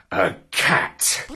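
ordinary delivery

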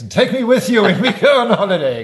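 laughing. Real, not acted. Joking request, laughing.

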